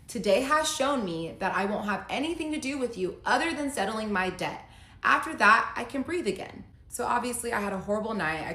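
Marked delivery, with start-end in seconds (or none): 0.1-6.6 a touch of angry husband, louder and higher; hammed-up bitter husband; mock angry husband. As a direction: read his text with an edge. Reading husband's cold breakup text.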